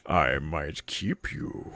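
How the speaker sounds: low slow amused menace; booming demon lord. Acted. DM voices boss about player.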